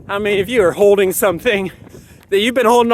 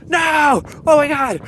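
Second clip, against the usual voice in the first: mock toddler tantrum; 9 semitones higher, slower than usual. Child's tantrum acted out.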